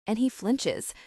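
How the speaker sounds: ordinary delivery, nothing marked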